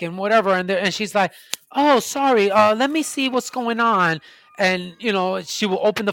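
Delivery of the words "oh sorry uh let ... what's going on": higher, louder mock-sorry doctor; mock apologetic doctor. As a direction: parody her fake apology, pitched up. Doctor voiced in a complaint story.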